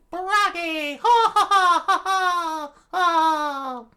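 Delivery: very high, loud shout into ho-ho laughter; Brogy's booming laugh. A narrator's reading. Giant announces his own name, booming laughing shout. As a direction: falsetto war cry with a big laugh.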